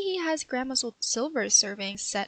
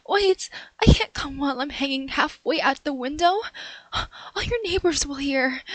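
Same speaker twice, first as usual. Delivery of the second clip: louder, hurried whispered protest; woman whispering urgently to the owner; 3 dB louder than usual. Acted. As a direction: hush him before the neighbors hear.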